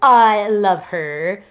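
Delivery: very slow, drawn-out declaration; band member voice. Acted. Reader as dark-haired band member confessing love, slow put-on voice. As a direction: stretch it into a hammed-up confession.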